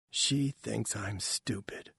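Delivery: very soft, slow murmur; hurt mother. A narrator's reading. Mother feeling belittled, quiet and slow. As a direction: say it small and slow.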